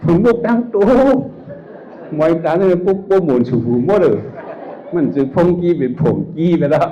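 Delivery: high, loud and laughing; amused outburst. Real, not acted. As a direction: push the joke out loudly through laughter. Carries on joking after laughing hard. (พูดเสียงดังสูงปนหัวเราะ)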